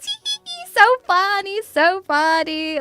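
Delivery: high "tee-hee" then drawn-out "so funny"; sarcastically tickled by a childish joke; mock giggly. Mocking own childish humour.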